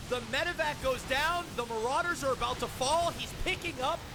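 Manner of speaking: rising, hyped commentary as the fight turns; live play-by-play. Acted. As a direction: call the comeback like a caster. Casts the StarCraft match.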